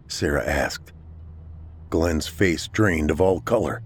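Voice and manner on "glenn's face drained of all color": ordinary delivery, nothing marked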